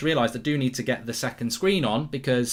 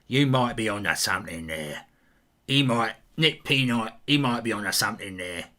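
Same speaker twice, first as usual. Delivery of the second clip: a lower, softer knowing voice; low put-on voice; 5 semitones lower, 4 dB quieter than usual. Streamer agreeing with a viewer in a lower, softer knowing voice.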